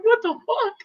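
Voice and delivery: reliving the shock of the energy rush, rising to a scream; astonished disbelief. Retold or imitated. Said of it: "I was like".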